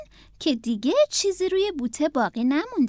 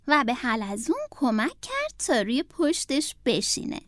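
usual speaking voice

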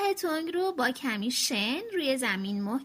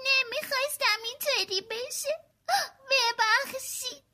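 tearful apology; 10 semitones higher than usual. Acted. Little sister apologizing to her brother, tearful apology. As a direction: plead sorry through tears. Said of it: "هریت گریه کنان گفت".

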